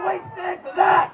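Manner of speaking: very high shouted challenge; escalating rallying shout. Real, not acted. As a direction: push the crowd harder for an answer. Repeats challenge to crowd.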